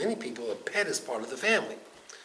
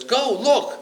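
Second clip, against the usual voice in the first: raised voice; 10 semitones higher, 10 dB louder than usual. Acted. Noah orders the raven.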